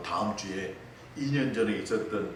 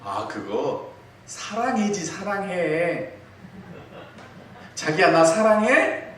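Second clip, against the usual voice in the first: husband's sweet answer; 8 semitones higher, 5 dB louder, slower than usual. Retold or imitated. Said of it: "뭘까요?".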